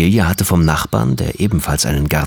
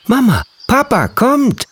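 put-on high, loud child's call; excited call; 3 dB louder than usual. A narrator's reading. Boy calling his parents to the pond, high and loud. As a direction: call the parents over in a rush. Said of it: "rief er".

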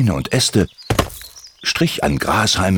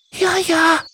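sehr hohe, piepsige Vogelstimme; piepsig. Acted. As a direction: wie eine kleine kranke Drossel piepsen. Doris antwortet. (Drossel Doris antwortet Timmy, hoch piepsend)

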